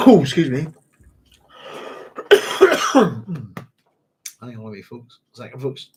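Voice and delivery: catching breath after choking. Real, not acted. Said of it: "Started choking a bit there".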